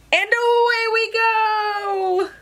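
high, long drawn-out cheer; joyful send-off. Acted. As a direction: sing it out slowly as you lift off. Takeoff refrain.

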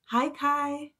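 ordinary delivery, nothing marked

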